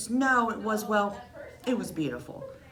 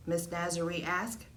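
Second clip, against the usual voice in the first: ordinary delivery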